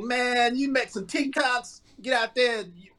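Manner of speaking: high, pushy hype talk; hyping him up. Retold or imitated. Andre urging Jeffrey to make TikToks for money, high and laughing.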